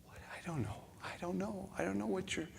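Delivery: flustered whisper. Retold or imitated. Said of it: "And I thought".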